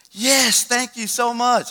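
high, drawn-out grateful gush; imitating a delighted stranger; excited thanks. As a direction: gush like a thrilled tourist. Grateful strangers offered a photo.

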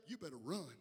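usual speaking voice

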